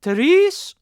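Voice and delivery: high, loud call through the door; loud summons to the next room. Acted. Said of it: "and cried".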